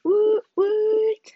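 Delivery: excited cheer. Real, not acted. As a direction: cheer it like a small party. Celebrating the weekly schedule.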